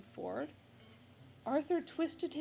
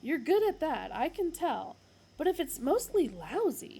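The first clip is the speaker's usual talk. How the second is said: higher, louder flattery; butter him up; louder, higher cajoling Ford; Ford's voice; 7 semitones higher, 9 dB louder than usual. Acted.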